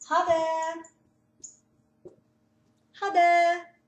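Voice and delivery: "ha det" said as "ha de", rising and loud; teacher models a Norwegian goodbye. Shown as a demonstration. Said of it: "nosso tchau".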